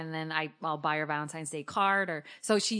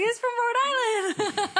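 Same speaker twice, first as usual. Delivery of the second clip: high, drawn-out mock squeal with laughter; gleeful high-pitched exclamation, breaking into a laugh; excited squeal; 5 dB louder, slower than usual. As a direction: squeal it proudly, stretching the words. Acts out own delighted reaction.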